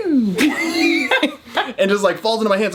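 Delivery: high, slow, laughing retelling; 高音边笑边慢慢讲. Retold or imitated. Baby sliding out retold.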